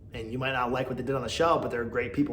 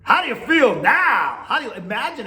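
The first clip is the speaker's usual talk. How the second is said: high, loud taunting jab; 12 semitones higher, 8 dB louder than usual. Real, not acted. Podcaster taunting Brittany over what she did. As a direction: rub it in her face. Scolding a houseguest.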